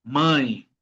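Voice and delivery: "Mãe" with a nasal gliding vowel. Shown as a demonstration. Teacher giving an example of the tilde, nasal "Mãe". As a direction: let "Mãe" ring through the nose. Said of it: "con un sonido nasal".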